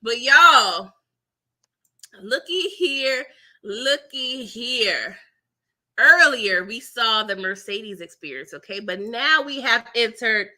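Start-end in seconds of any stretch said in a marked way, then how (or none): 2.2-5.2 drawn-out call. Real, not acted. Announcing new segment slowly.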